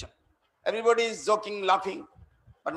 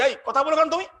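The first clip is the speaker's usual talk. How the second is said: raised, louder rebuke to a talker; sharp scolding; 5 semitones higher, 3 dB louder than usual. Real, not acted. Monk scolding a chatting listener, sharply raised voice. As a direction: cut the chatter short, higher and louder.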